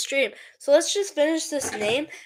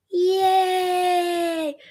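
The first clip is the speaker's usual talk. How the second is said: long, higher-pitched cheer; drawn-out yay; 4 semitones higher than usual. Pretend. Streamer cheering for the viewers, drawn-out yay. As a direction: stretch out one long "yay".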